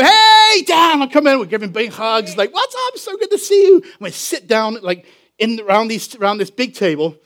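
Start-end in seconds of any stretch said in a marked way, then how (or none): 0.0-1.0 high, loud drawn-out hail; boisterous greeting. Retold or imitated. Friends hailing Dan as he comes in, boisterous. 2.5-3.8 high-pitched excited hello; gushing greeting. Retold or imitated. Friends giving big hugs on meeting, imitated high gushing welcome.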